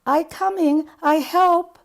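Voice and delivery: high, loud call for help; shout. Acted. Survivor calling to buried coworkers after the blast, loud shout. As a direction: cry out to the trapped workers from the rubble. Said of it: "crawl out of rubble and shout".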